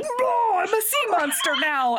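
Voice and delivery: high, hammed-up monster roar; mock sea monster. Man pushed into the lake to his attacker, mock monster voice. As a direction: bellow it as a silly monster.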